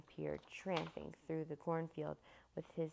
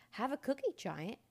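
offering up; 6 semitones higher, 4 dB louder than usual. A narrator's reading. Boy offers giant a cookie.

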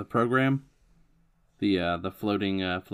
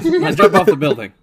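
ordinary talk

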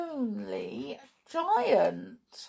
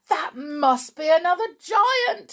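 excited; 8 semitones higher, 5 dB louder, faster than usual. Acted. Giant's hopeful thought.

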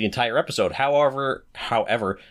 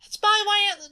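put-on British girl voice; slower than usual. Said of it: "trying to sound like a little British girl".

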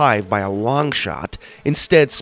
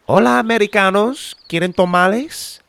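put-on sweet old Spanish-speaking woman; 11 semitones higher, 5 dB louder than usual. Sweet older woman calling.

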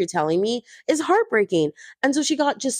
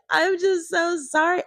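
high, slow, hammed-up weepy sorry; mock crying Teresa; 8 semitones higher than usual. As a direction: drag out a fake-tearful sorry.

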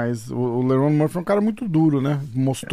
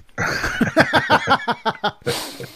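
breathy laugh; 11 semitones higher than usual. Real, not acted. Laughs at own full mouth.

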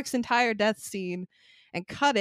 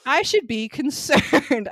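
slow, loud mock-serious line; mock serious self-aware; mock deadpan; 4 semitones higher, 6 dB louder, slower than usual. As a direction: say it like a deadpan hero. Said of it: "he's like".

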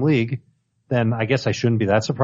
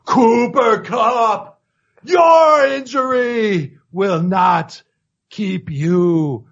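booming wrestler battle cry; Ultimate Warrior voice; 5 semitones higher, much slower than usual. Retold or imitated. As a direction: roar it like a pro wrestler promo. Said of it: "in the Ultimate Warrior voice".

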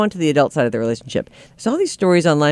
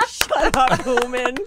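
imitated yell; 7 semitones higher, 3 dB quieter, much slower than usual. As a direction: replay the scream higher but held back. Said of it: "She yelled".

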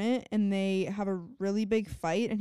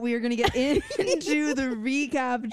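laughing; 4 semitones higher, 5 dB louder than usual. Real, not acted. Laughing after vampire voice.

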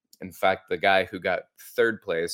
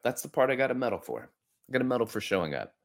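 ordinary delivery

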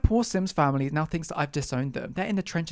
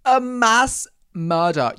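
much higher, louder, very slow mock wail; hammed-up grief over lost Sims saves; anguished wail; 6 semitones higher, 9 dB louder, much slower than usual. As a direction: wail it like a tragedy. Repeats it louder.

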